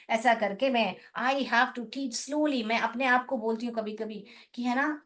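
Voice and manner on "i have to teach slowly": very slow, drawn-out words; teaching yourself to slow down, spoken slowly. Shown as a demonstration. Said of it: "हमें slowly पढ़ाना है".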